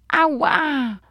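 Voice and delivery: high, drawn-out "ow"; hammed-up storybook yelp; cry of pain. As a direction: yelp as if you just crash-landed. Star crashes and cries in pain.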